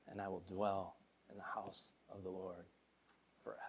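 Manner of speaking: low, soft reverent declaration of the psalm; solemn scripture recitation. Acted. Pastor closing his sermon with a hushed, reverent psalm. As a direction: speak the psalm softly, like a vow.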